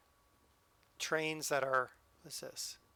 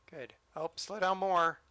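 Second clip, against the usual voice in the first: normal talking voice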